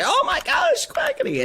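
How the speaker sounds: very high excited squeal; starstruck delight. Retold or imitated. Fan spots Quackity in the lounge, acted starstruck squeal. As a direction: squeal the name in hammed-up delight. Acting out spotting a friend.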